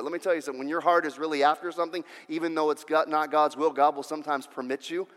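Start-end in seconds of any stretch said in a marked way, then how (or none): none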